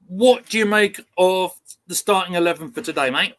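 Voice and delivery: normal talking voice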